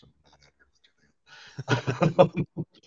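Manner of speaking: unvoiced whisper, slow and joking; whispered aside. Real, not acted. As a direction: whisper it to the listeners like a secret.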